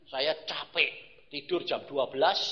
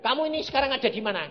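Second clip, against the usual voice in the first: loud, high, fast question; insistent retold question; 11 semitones higher, 9 dB louder, much faster than usual. God presses the drowsy preacher, loudly insistent. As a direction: repeat it forcefully, rushing the words. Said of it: "saya ditanya".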